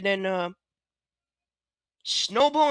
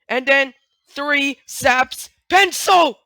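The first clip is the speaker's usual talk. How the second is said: ordinary talk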